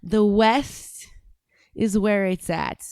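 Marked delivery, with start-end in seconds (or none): none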